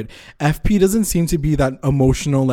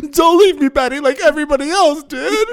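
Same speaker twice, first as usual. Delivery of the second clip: much higher, louder melodramatic wail; overacted desperate cry; mock wail; 13 semitones higher, 4 dB louder than usual. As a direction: wail it like a soap opera mother. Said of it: "She's like".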